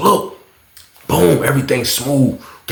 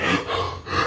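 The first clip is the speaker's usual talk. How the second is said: sharp soft gasp; 7 semitones lower, 11 dB quieter than usual. Retold or imitated. Imitating the guy gasping after throwing up. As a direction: suck in air hard.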